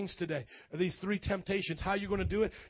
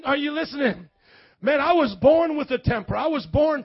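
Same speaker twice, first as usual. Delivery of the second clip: loud, higher preacher's shout; fiery preaching; 6 semitones higher, 7 dB louder than usual. Acted. Preacher to the congregation, shouting and rousing. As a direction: rouse the crowd.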